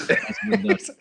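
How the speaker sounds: the title said through a laugh. Retold or imitated. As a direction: chuckle the lyric out quietly. Quoting song again, laughing.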